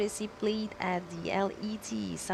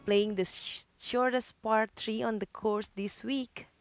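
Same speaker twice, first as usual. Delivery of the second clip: golf commentator; 2 semitones higher than usual. Acted. Sponsored hole feature read.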